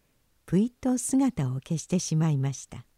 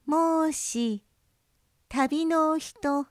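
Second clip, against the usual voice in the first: put-on child fox voice; 9 semitones higher, much slower than usual. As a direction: gently call a lost traveller. Fox child calls from the boat.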